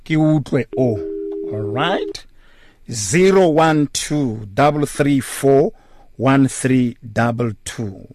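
ordinary delivery

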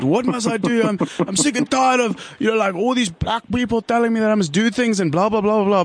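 high mocking jock English complainer; exaggerated jock English moan, mimicking the fed-up; jock English accent. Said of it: "a jock English accent".

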